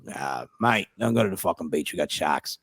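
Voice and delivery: low, soft Australian warning; Australian accent. Retold or imitated. Australian comic warning visitor off the beach, Australian accent. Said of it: "It's a good Australian accent".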